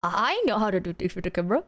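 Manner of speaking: teasing imitation of the streamer; mocking echo. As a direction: parrot him back deadpan. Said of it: "mocking echo of his line".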